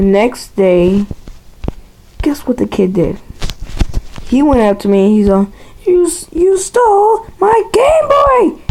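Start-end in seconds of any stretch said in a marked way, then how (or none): 5.8-8.6 very high, drawn-out whiny accusation; hammed-up squeal of a wronged kid; mock angry kid. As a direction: imitate an upset kid wailing in a high voice. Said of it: "his freaking face was all red".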